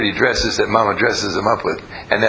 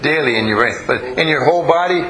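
ordinary delivery

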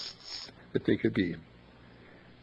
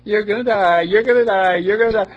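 mock taunt; 7 semitones higher, 8 dB louder than usual. Repeats the nurse's taunt.

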